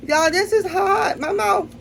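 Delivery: high, strained laugh-cry through the burn; real laugh-cry from spice; laughing in pain. Real, not acted. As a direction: laugh and whimper as the heat hits.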